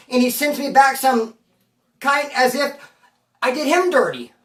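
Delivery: ordinary delivery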